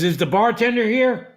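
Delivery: high, bright mock termite voice asking for the bartender; telling the termite joke to the table, high mock voice for the punchline. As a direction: pitch the termite's question up high as the joke's punchline. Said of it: "How about the termite goes into a saloon?".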